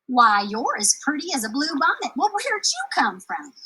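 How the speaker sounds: charmed by a tiny visitor; kindly giantess welcoming the girl; giant woman's voice. Acted.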